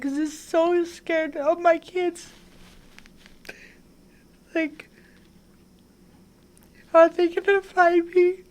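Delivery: fighting tears while she remembers; voice breaking. Real, not acted. Telling the host about her fear for her kids, near tears.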